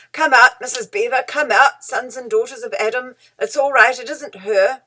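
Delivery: loud, eager calling out; excited shout. A narrator's reading. Mister Beaver shouting excitedly to Missus Beaver and children. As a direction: shout them out of the cave with glee. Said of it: "he was shouting".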